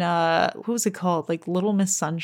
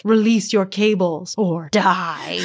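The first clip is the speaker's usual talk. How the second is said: slow, cheesy pirate threat; movie pirate; slower than usual. Acted. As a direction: draw out a hammed-up villain's ultimatum. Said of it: "one pirate is like".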